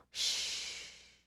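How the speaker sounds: hushed breathy snore sound; pretend snore. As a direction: fake a sleepy snore. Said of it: "pretend to go to sleep".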